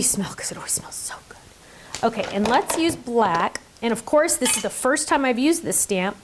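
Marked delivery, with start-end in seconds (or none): 0.0-2.0 breathy whisper about the smell. Real, not acted. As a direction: whisper it like a secret treat. Sniffing the stamp ink.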